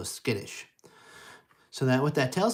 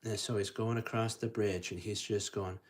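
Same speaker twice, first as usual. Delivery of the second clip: ordinary talk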